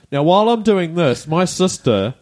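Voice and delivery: ordinary talk, nothing marked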